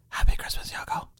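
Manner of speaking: breathy whispered wish; whispering. Shown as a demonstration. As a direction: whisper it softly. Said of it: "Can we stop whispering?".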